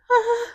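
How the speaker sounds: soft, high imitated toddler squeal; a tiny squeak, kept quiet; super low-volume squeal. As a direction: mimic a child's hushed little squeal. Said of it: "super low".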